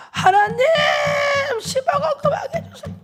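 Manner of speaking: high anguished shout; crying out to God. Retold or imitated. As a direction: cry out to God with everything. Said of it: "방바닥을 치면서 막". (방바닥을 치며 하나님께 울부짖는 외침)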